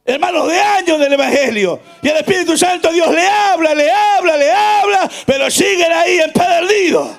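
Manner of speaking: insistent warning with long-held vowels; full-force preaching. Acted. Preacher warning hardened churchgoers, full-force preaching. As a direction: build into a ringing, drawn-out warning.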